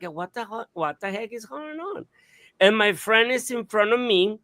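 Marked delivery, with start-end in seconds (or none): 0.0-2.1 much softer, bewildered undertone; quiet retold disbelief; hushed. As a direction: say it under your breath, puzzled. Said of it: "my friend is so quiet".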